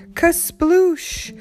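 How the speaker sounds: loud, high splash word; big splash. A narrator's reading. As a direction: burst out the sound of the splash. Splash sound effect.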